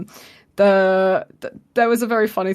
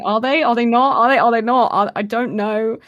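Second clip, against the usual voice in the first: rising panicky questions, louder; flip-flop nervously between yes and no; anxious dithering; 4 semitones higher, 6 dB louder than usual. Retold or imitated. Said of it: "I've been flip-flopped back and forwards".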